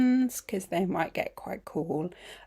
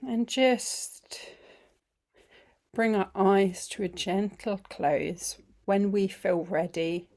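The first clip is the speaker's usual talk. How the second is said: guided meditation; 5 dB quieter than usual. Acted. Teacher easing the group into feeling the body, guided meditation. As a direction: guide them gently through it, phrase by unhurried phrase.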